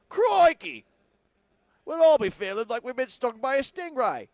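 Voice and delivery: very high, loud Australian crikey; Australian accent. Pretend. Host predicting Texans record, in a loud mock Australian accent. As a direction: burst out like an excited Australian wildlife host. Steve Irwin bit again.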